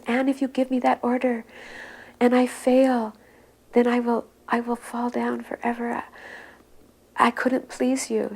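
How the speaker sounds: put-on high, childlike begging; tearful plea. Weeping disciple begging his guru, high and childlike. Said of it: "weeping like a little baby".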